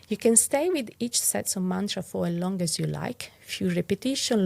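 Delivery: ordinary talk, nothing marked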